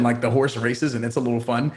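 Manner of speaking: normal talking voice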